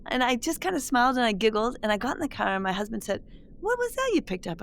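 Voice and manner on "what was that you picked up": husband's question. Retold or imitated. Husband's question voiced in story.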